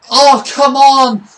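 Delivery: loud, drawn-out exasperated cry; frustrated groan. Real, not acted. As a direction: let the frustration burst out slowly. Frustrated at missed chance.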